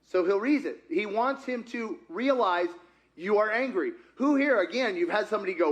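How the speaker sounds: usual speaking voice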